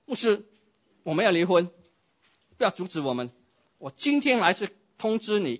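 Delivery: high, loud insistent demand; demanding outburst. Acted. Spouse to pastor, demanding a divorce, loud. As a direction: storm in and announce it.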